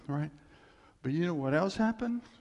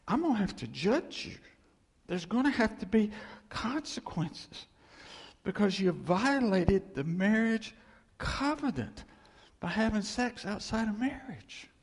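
high, stern pronouncement; God getting mad; 5 semitones higher than usual. Acted. God judging a broken marriage covenant, stern and mad. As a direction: lay down judgment in a raised voice. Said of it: "that's what made him mad".

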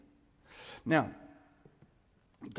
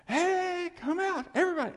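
high, loud hollering to a crowd; mock general summons; 7 dB louder than usual. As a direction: holler everybody out, for laughs. Mock call to everybody at once.

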